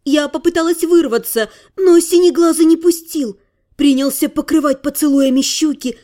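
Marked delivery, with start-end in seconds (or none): none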